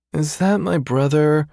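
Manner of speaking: lazy drawl. Acted. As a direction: toss it off without a care. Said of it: "say lazily".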